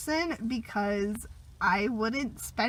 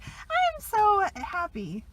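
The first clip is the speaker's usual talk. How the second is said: mock happy; 9 semitones higher, much slower than usual. Mock-happy quote of herself.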